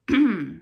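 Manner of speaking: short, loud throat clear. A narrator's reading. Spokesman before answering a reporter, clearing his throat. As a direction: clear your throat pointedly. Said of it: "again cleared his throat".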